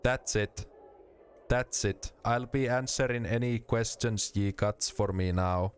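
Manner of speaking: put-on a Finn faking a Finnish accent; fake Finnish accent. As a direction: put on rally-English Finnish. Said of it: "You sound like a Finn putting on a fake Finnish accent".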